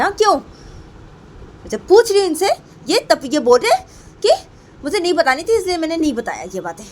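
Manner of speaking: high, loud, indignant demands; very angry. Acted. Payal demands why he hid things from her, very angry. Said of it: "मुझे बहुत गुस्सा आ रहा है इनके ऊपर".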